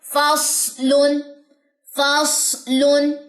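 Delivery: फसलुन drawn out with a hissing स; फसलुन said slowly for the class; slow recitation demo. Shown as a demonstration. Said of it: "example".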